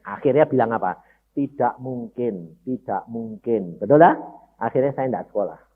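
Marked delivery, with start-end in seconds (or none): 1.4-3.8 slow and quiet, resigned; a quiet, drawn-out refusal of hope; slow hopeless no. Retold or imitated. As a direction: let each word drop heavily and softly. Recalls his own hopeless answer.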